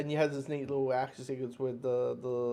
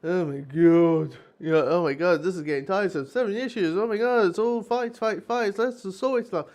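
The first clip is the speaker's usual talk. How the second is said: loud, higher, fed-up groaning; mock exasperation; 4 semitones higher, 7 dB louder than usual. Reader imagining himself bored by fight issues, loud mock exasperation. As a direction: whine it like you're sick of endless fights. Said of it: "seven issues of me just saying".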